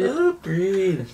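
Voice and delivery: high, stretched and falling; high echoed catchphrase. Acted. Echoes the other host's catchphrase.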